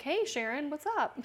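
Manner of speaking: high, breezy phone hello; retold chirpy hello; cheerful greeting. Retells her cheery phone greeting.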